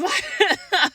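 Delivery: bright high burst of laughter; real laugh. Real, not acted. Laughing while telling the story. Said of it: "So I started laughing".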